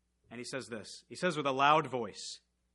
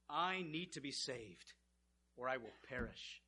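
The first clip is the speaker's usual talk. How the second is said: quiet fear; 3 semitones lower, 12 dB quieter than usual. Acted.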